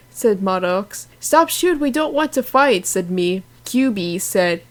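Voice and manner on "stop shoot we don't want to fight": high pleading cry to stop the fight. Acted. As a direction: beg them to stop, pitched up. Character begs fighters to stop.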